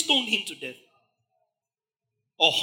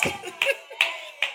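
breathless high laugh. Real, not acted. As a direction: burst out laughing. Laughter within mob scene.

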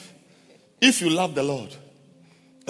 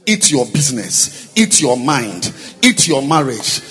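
fiery prayer; 10 dB louder than usual. Real, not acted. Pastor praying down enemies, building to a loud shout. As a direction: hammer each "eat" harder until you're crying out.

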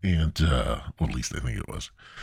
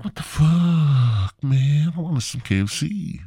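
outraged whine; 8 semitones higher than usual. Retold or imitated. Told his KFC order is dropped, outraged high wail. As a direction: wail it like a cheated child.

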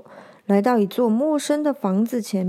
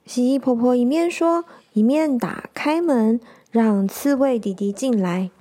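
plain reading voice

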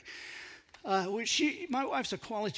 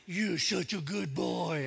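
put-on slow, cooing good-boy praise; praising a dog; 4 semitones lower, slower than usual. Owner praising the puppy, slow and cooing. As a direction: coo it slowly to a good dog. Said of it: "trying to puppy dog her".

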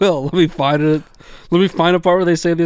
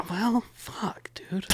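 1 whispered curse; 6 semitones higher, 11 dB quieter, much slower than usual. Real, not acted.

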